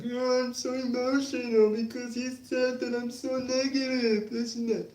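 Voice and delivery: mock a fan falling apart over a comment; mock emotional crybaby. Mocking critics who expect him to cry, hammed-up weepy voice. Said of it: "It's not gonna make me cry right now and be like".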